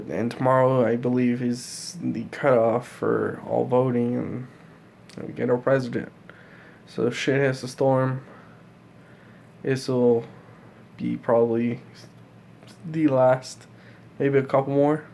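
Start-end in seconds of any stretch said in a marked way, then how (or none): none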